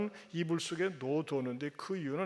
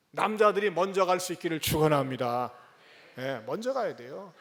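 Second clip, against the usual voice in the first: ordinary delivery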